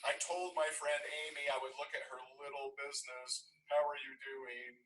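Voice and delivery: patronize the little guy; imitating a haughty agent; condescending big shot. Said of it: "this is big Barbara".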